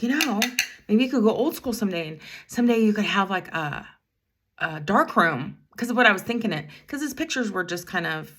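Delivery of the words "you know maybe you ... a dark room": bright, higher and louder pitch of encouragement; eager encouragement. Retold or imitated. Bus driver eagerly encouraging a teen boy, higher and louder. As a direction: pile on the enthusiasm a bit too much. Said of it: "I might have gone a little too far".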